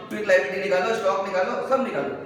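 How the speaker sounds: ordinary talk, nothing marked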